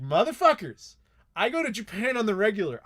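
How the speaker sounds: mock boastful shout. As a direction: throw it back at the family teasing you. Retold boast to mocking family.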